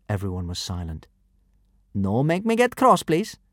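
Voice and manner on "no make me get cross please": high warning from the brute. A narrator's reading. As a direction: threaten with fake politeness. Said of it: "warned the first kidnapper".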